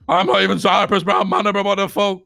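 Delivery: high, indignant retold outburst; imitating a heated, high-pitched plea; agitated protest.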